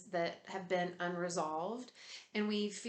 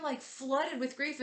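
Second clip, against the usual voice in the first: usual speaking voice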